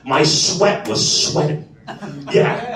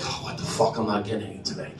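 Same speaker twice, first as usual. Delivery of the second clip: muttered grumble; 9 semitones lower, 12 dB quieter, faster than usual. Retold or imitated. Muttered aside to himself.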